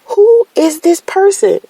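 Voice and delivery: high, indignant inner voice; mock outrage at a late-night caller; incredulous. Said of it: "I'm like".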